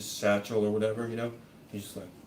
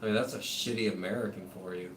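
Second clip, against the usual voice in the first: ordinary talk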